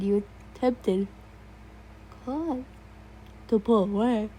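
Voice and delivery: words stretched through a yawn. Real, not acted. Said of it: "I hate myself for yawning".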